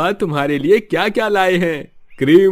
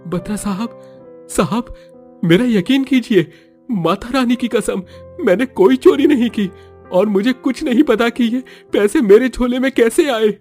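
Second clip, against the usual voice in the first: desperate pleading; 10 dB louder than usual. Acted. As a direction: beg for mercy, voice raised. Innocent man pleading.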